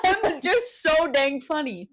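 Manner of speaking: high, slow words squeezed through laughter; cry-laughing at her own joke; laughing to tears. Real, not acted. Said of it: "The tears in your eyes are insane".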